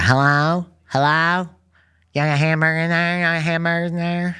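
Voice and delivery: high, loud mock dad at the drive-thru. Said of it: "he would raise his voice".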